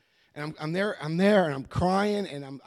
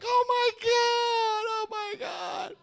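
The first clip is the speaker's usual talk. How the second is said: high, slow, crying mock shriek of a horrified mother; 3 dB louder, much slower than usual. Mother hearing her son broke into houses, hammed-up wail. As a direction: wail it like a scandalized mom. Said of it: "And my mother's like".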